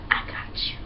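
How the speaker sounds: whispered secret promise; breathy whispered I got you. Retold or imitated. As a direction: hush it like a conspiracy. Waiter quietly reassures them.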